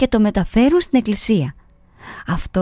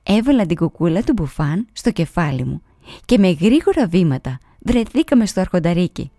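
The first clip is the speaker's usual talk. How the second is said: usual speaking voice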